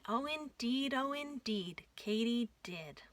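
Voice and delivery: plain reading voice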